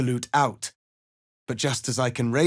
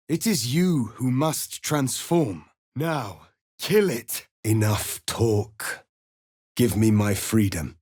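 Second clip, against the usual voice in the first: ordinary talk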